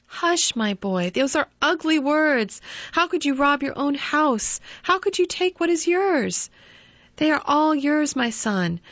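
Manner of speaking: loving mother calming her guilty son; tender mother. Acted. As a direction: hush him gently like a mother. Narrator voices the mother.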